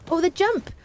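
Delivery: high delighted gasp over the jump; excited squeal. Real, not acted. Streamer thrilled by a jump, squealing high. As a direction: squeal with joy at the big jump. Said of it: "I love that feeling".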